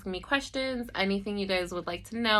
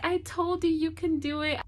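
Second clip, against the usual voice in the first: higher, tearful grandmother voice; grandma's voice; 9 semitones higher than usual. Retold or imitated. Grandma crying at the door to her granddaughter, higher tearful voice. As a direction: speak as the proud grandma, choked up. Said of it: "And she was like".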